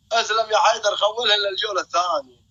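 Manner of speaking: ordinary talk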